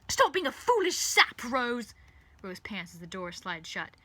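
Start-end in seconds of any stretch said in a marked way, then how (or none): 0.1-1.9 very loud, high-pitched angry yell; enraged outburst; furious shout. Acted. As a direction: bellow at someone running away. Villain yelling at fleeing Rose.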